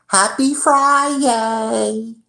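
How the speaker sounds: very high, slow, drawn-out mock cheer; sing-song happy friday. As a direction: ham up the fake-cheerful boss greeting. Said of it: "he's like".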